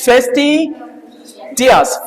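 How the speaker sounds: फेस्टिडियस drawn out slowly, syllable by syllable; slow model pronunciation. Shown as a demonstration. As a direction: stretch फेस्टिडियस out for the class. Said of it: "ये क्या है आपका?". (धीमा, साफ़ उच्चारण)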